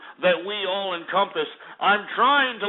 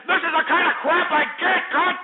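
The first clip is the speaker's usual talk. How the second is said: higher and louder, fed up; exasperated outburst; 9 semitones higher, 4 dB louder than usual. Real, not acted. Host loses patience with his callers, higher exasperated outburst. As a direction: let the anger crack through on the repeat. Repeating complaint, building up.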